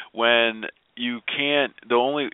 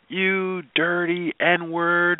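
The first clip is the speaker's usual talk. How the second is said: retold hateful outburst; 9 semitones higher than usual. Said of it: "she proceeded to be like".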